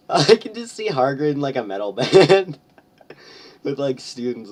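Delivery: high, loud words breaking into laughter; giggling through the image of Hagrid in a band; laughing. Real, not acted. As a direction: crack up while picturing it.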